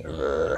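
imitated dog's low "grrr"; puppy growl. Shown as a demonstration. Teacher compares R to puppies growling, imitated growl. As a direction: growl like a small dog. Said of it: "으르렁거리는 소리".

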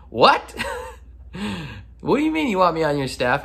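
baffled, high-pitched "what?"; mock disbelief. Admiral reacting to being wanted on staff, mock incredulous.

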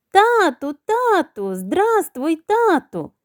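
high, eager little chicks greeting dad; excited chicks. A narrator's reading. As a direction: call out high and excited, like children to their father. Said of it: "застрибали навколо півня курчата".

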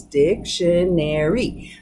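"dictionary" drawn out slowly, in four separate syllables. Shown as a demonstration. Teacher counting out the syllables for learners, slow and separated. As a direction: break "dictionary" slowly into dic-tion-ar-y, each part distinct. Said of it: "four syllables".

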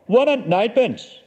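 ordinary delivery, nothing marked

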